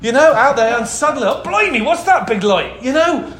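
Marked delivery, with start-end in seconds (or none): 1.3-2.8 startled mock-British shepherd; hammed-up British "blimey" accent, raised voice; comic British accent, starting high; put-on British accent. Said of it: "Don't know why I did that accent".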